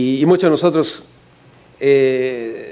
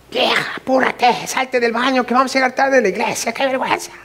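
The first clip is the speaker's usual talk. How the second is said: high, put-on voice of a husband nagging his wife; impatient husband; 8 semitones higher than usual. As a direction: hurry her out of the bathroom, mock frantic. (marido apurando a su esposa, voz aguda y exagerada)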